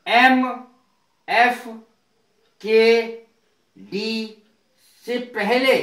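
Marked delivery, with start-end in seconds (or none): none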